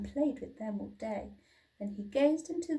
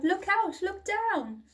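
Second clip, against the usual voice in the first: much higher and louder warning; urgent yell; 10 semitones higher, 6 dB louder than usual. A narrator's reading. Drip yelling a warning to his sisters. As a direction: shout the warning. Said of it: "who yelled".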